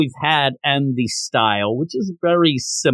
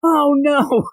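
high-pitched wail of dismay; mock yell; 12 semitones higher than usual. Reviewer imitates the character seeing the ghost, mock yelling. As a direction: cry it out high without real volume. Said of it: "He's yelling".

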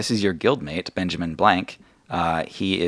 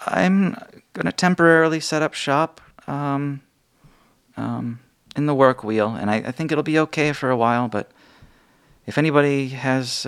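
higher, halting voice full of ums; ruined shopkeeper asking for funds, higher and hesitant; Mina, hesitant shopkeeper; 7 semitones higher than usual. Acted. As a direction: admit you're struggling. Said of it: "Mina steps forward, and she says".

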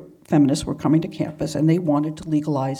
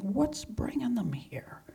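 slow, hushed wondering to herself; soft whisper, slowly puzzling; whispered thought; 4 semitones higher, 8 dB quieter, slower than usual. Retold or imitated. Inner thought at the clinic.

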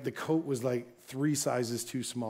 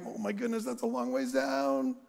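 mock scared; 8 semitones higher than usual. Looking over the cliff edge.

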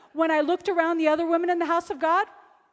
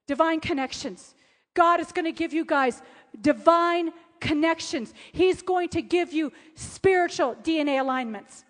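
ordinary delivery

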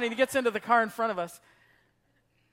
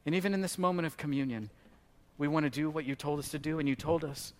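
hushed closing prayer; 4 semitones lower than usual. Acted.

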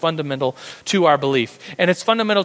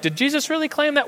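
mock doubting question; 10 semitones higher, faster than usual. Pastor voices a doubting listener, mock questioning. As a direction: voice a doubter, pitched way up. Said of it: "and you're like".

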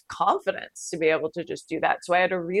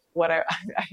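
ordinary talk, nothing marked

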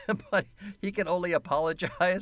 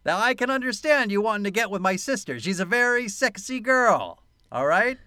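higher, louder, swaggering thug; tough guy voice; 6 semitones higher, 5 dB louder than usual. Retold or imitated. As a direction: play it like a cocky gangster granting permission. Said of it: "then when he says".

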